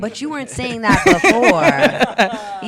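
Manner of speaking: loud, high, slow, drawn-out comeback; sassy retort. Retold or imitated. To fans who once doubted her, a loud slow sassy comeback. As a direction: drag it out, calling them out.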